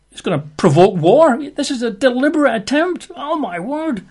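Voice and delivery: high, frantic, rising panic; the king of Israel panicking over the letter, high and frantic; panicked king. Acted. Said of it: "he's really in a bit of a panic".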